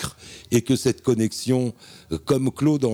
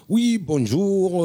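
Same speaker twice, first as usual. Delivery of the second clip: high and slow phone greeting; a drawn-out, sung-up hello into the receiver; answering the phone; 8 semitones higher, much slower than usual. Retold or imitated. Guest acting how he picked up Tatou's call, high and slow.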